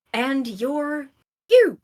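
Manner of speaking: sudden high, loud exclaim; dramatic reveal. Acted. Game master springing the twist on the players, loud. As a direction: hit the reveal like a jolt.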